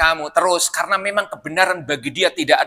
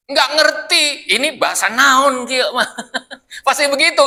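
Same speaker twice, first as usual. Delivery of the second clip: talking through laughter, higher; laughing; 7 semitones higher than usual. Real, not acted. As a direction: chuckle through the joke. Jokes about Sundanese, laughs.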